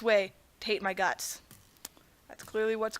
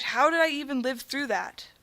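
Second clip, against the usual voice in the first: usual speaking voice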